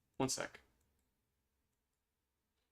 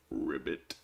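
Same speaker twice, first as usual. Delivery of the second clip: low, soft frog ribbit; frog croak; 13 semitones lower, 7 dB quieter than usual. Acted. Voicing the frog looking at a lily pad painting, low croak.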